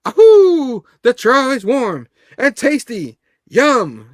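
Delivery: whooping high and loud, then slow savoring; giddy excited yelp. Retold or imitated. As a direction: shriek it, then relish every word. Character licking the building.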